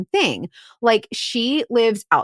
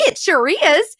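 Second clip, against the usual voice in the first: put-on high-pitched Southern old lady impersonation; little old lady; 8 semitones higher, 4 dB louder than usual. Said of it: "little old lady".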